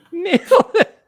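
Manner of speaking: high laughing cry; laughing excitedly. Real, not acted. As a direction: laugh it out in disbelief. AI ties the score.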